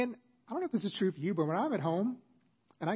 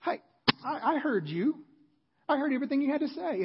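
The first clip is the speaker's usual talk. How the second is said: higher, exasperated "I heard you"; mock indignant kids; 9 semitones higher, 3 dB louder than usual. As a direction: fire it back defensively. They'll fire back at me.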